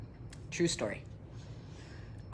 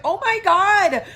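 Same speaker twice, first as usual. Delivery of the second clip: gushing back; 7 semitones higher, 5 dB louder than usual. Retold or imitated. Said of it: "And I was like".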